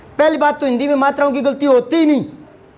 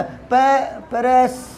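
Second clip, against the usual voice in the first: mock struggling student; 2 semitones lower, 5 dB quieter, much slower than usual. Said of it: "कई बच्चों को प्रस्तावना लिखा था".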